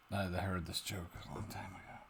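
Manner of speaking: hushed, breathy whisper; a secret whispered aside. Real, not acted. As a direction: lean in and whisper it.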